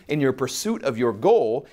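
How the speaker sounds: ordinary delivery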